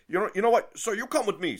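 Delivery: loud, high, worked-up command; imitated irate clerk; angry order. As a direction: order them along in a huff. Angry clerk ordering him along.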